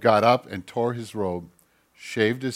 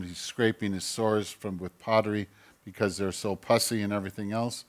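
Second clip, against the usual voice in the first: ordinary delivery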